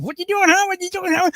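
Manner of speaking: high parrot mimicry, taunting. Jealous parrot taunting its owner's new husband in a high parrot voice. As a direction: chirp it up high like a pestering parrot. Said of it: "and this thing".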